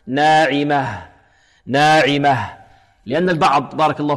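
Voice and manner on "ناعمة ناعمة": ناعمة slowly, ending in an audible breathed h; clear final ha. Shown as a demonstration. Quran teacher shows the pause form, clear final ha. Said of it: "فلا بد من بيانه حالة الوقف".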